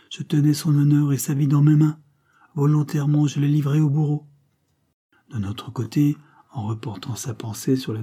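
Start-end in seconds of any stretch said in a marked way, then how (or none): 0.1-4.3 anguished declaration. A narrator's reading. Imagined confession to her daughter.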